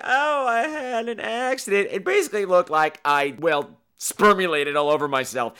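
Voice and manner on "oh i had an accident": sheepish excuse. Retold or imitated. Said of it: "And I'm like".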